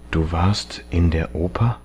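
hushed, pained question; sehr leise, gepresst. A narrator's reading. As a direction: speak very softly, the voice pressed with pain. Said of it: "Er sprach sehr leise". (sehr leise, schmerzhaft gepresst)

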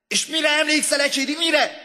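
high, shouted question; urgent demand. A narrator's reading. Man questioning his sleeping classmate, urgent high shout. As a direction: press him at full shout.

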